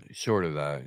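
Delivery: short of that, let it drop away; losing the thread as you repeat; trailing off. Real, not acted.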